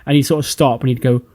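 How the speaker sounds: normal talking voice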